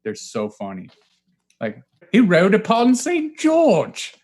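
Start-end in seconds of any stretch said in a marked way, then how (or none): 2.1-4.1 high, slow theatrical recital; mock old-timey proclamation. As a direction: proclaim it in a pitched-up, stretched-out voice.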